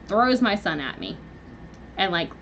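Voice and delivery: ordinary talk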